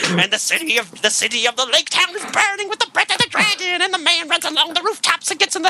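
high epic metal narration; heavy metal screechy voice. Acted. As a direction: proclaim it like a metal concept album. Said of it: "heavy metal screechy voice".

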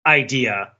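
usual speaking voice

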